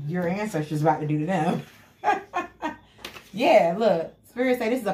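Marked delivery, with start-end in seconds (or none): 2.0-2.7 cackling laugh. Real, not acted. Laughs at own reading.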